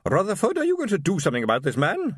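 high, haughty matron summoning her husband; imperious wife. A narrator's reading. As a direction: sharply call your husband to order. Wife voiced in story.

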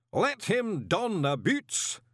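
high, very slow intoned command; ceremonial voice. A narrator's reading. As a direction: speak it as if in capital letters. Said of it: "the voice went on".

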